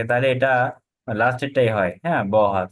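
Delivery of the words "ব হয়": ordinary talk, nothing marked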